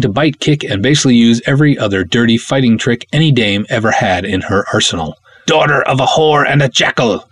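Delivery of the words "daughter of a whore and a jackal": higher, altered voice spitting a curse; cursing princess. Acted. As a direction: hurl it at her like a curse. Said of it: "she curses".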